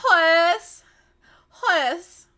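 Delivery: loud, high name call; high shouted one-word hello; Nicole Byer. Retold or imitated. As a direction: holler the name twice in greeting. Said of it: "that's how she says it".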